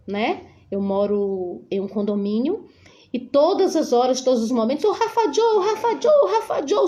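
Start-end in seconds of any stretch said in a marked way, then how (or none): 4.8-6.9 high sing-song call across the condo. Retold or imitated. Friends calling her son from below, high sing-song call.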